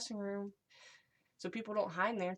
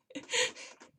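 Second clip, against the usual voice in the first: squeaky laugh; 4 dB louder than usual. Real, not acted. Reacting to the meme.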